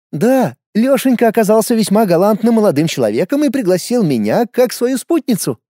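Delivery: a hint of a sly older woman; cheeky, knowing woman's voice; lightly voiced Jones. A narrator's reading. (лёгкий голос Джонс)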